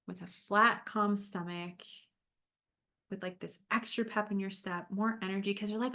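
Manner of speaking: gentle, unhurried guiding voice; calm coach leading a visualization; guided visualization. Acted. Leads a visualization.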